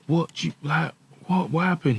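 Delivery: high, strained cry of disbelief; distressed disbelief. Retold or imitated. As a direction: ask it in shock, voice up high. Retelling shock at dad's death.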